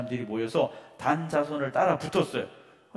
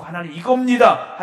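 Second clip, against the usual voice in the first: loud insistence, higher pitch; mock stubborn prayer; 5 semitones higher, 15 dB louder than usual. Preacher imitating a self-willed believer, loud and insistent. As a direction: tell God what you want, loudly and higher. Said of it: "하지 마시고".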